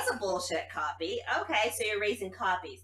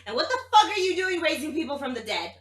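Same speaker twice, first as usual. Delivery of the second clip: high, loud, fast demand; indignant outburst; 9 semitones higher, 6 dB louder, faster than usual. Real, not acted. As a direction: challenge him hard. Player confronts NPC.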